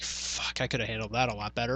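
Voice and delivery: voiceless mutter of regret; soft muttered regret under the breath; whispered self-reproach. Retold or imitated. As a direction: whisper it to yourself, kicking yourself. Regretting the outburst, to himself.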